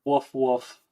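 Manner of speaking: a light, mock "Woof, woof" like a dog. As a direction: bark it playfully, only lightly. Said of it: "the audio of him saying woof".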